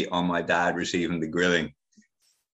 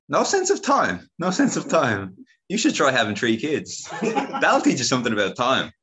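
mocking relative; 7 semitones higher, 3 dB louder than usual. Woman mocks his meditation claim.

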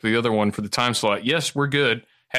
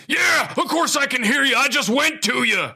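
pissed-off Alex Jones; 13 semitones higher than usual. Retold or imitated. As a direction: snap back at the caller. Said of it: "pissed off".

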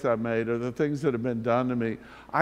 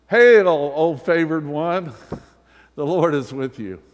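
playful greeting; 2 semitones higher, 8 dB louder than usual. Pretend. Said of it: "is to say to each other".

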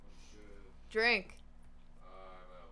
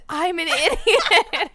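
mock shout; 6 semitones higher, 8 dB louder, slower than usual. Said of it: "no one ever shouts".

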